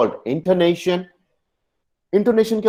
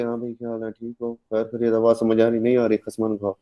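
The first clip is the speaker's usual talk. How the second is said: softer, all on one level note; monotone; 4 dB quieter than usual. Shown as a demonstration. Teacher demonstrates dull talkers who bore listeners, monotone. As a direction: drone it without any rise or fall. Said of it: "ये बिल्कुल ऐसे".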